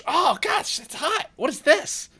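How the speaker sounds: very high, loud alarm; squealing, excitable character in shock; startled yelp. Acted. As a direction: yelp as if just burnt, still amazed. Character burns himself on stove.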